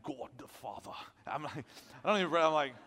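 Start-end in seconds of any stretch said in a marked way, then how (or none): 0.0-0.9 low, solemn mock-holy voice; deep pompous prayer voice. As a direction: imitate a pompous preacher praying deep. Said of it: "they just pray so deep".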